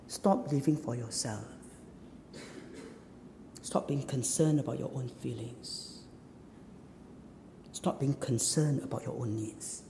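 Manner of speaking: low, soft preaching with long pauses; solemn exhortation. Acted. Preacher urging the congregation off selfishness, solemn exhortation. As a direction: bring it down to a quiet, grave appeal.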